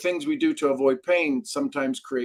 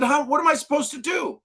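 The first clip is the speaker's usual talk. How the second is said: higher, pleading disbelief; helpless outcry; 13 semitones higher than usual. Retold or imitated. Pleading with his mentor over the ruined house, higher. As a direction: ask it as if lost. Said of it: "I said".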